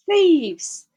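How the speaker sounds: loud high shriek; rat's shriek. A narrator's reading. Rat shrieks after the foxes, high and loud. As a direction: shriek it at the fleeing thieves. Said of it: "shrieked the rat".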